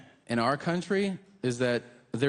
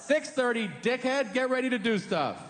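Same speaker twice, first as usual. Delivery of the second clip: bossy world; 9 semitones higher than usual. Retold or imitated. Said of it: "the world goes".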